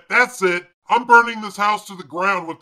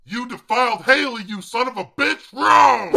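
furious shout; 6 semitones higher than usual. Acted. Furious row over defiled autograph.

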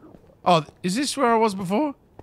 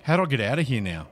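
ordinary delivery

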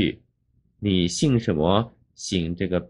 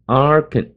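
"Arkan" with a strong AR, light kan; stress on first syllable; 6 semitones higher, 4 dB louder than usual. Shown as a demonstration. Teacher showing the heaviest part of Arkansas, "Arkan" stressed on AR. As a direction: hit the AR of "Arkan". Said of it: "这个地方最重".

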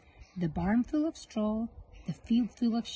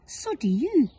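higher, louder snappy comeback; Hugh's quick cheeky retort; 6 semitones higher, 6 dB louder than usual. Acted. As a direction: fire back at him. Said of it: "And Hugh said".

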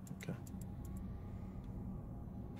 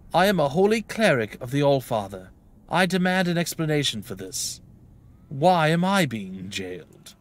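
mildly raised, proud protest; indignant cleric; 4 semitones higher, 5 dB louder, slower than usual. Acted. Cleric demanding why jailed, mild indignation. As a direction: demand answers with a touch of outrage.

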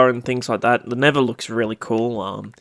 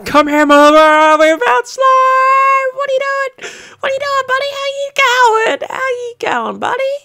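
very high, loud gushing over a cute new pet, easing down towards the end; over-excited about slime; 7 dB louder than usual. Pretend. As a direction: squeal with delight at your slime, then settle into doting vows. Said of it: "a little bit too excited about slime".

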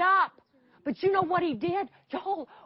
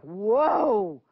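startled whoa; 5 semitones lower, 5 dB louder than usual. Retold or imitated. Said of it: "And so I was like".

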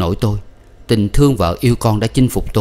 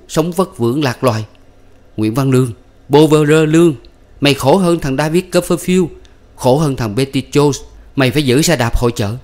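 higher, louder bitter tirade; indignant rant; 7 semitones higher, 3 dB louder than usual. A narrator's reading. Husband lashing out at his wife, indignant rant. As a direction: rant it out, hurt and furious.

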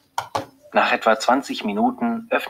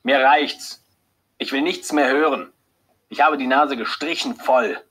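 angry; 5 semitones higher, 3 dB louder than usual. A narrator's reading. Husband interrupting the doctor.

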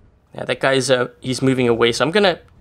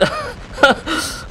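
breathless laugh; 14 semitones higher than usual. Real, not acted. After escaping rockets.

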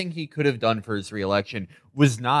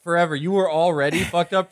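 higher, mocking gloat; mocking taunt; 7 semitones higher than usual. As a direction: rub it in with glee.

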